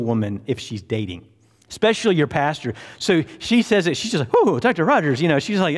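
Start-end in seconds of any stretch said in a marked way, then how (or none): 4.3-5.2 high, loud flustered Southern lady; mock a scandalized belle; flustered, raised-pitch belle imitation; ruffled Southern belle. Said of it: "she's all ruffled".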